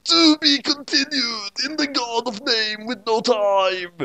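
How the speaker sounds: high, slow movie trailer announcement; mock trailer voice. Author plugs the next story, high slow trailer voice. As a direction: hype it like an ad.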